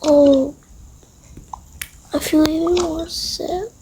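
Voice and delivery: ordinary delivery, nothing marked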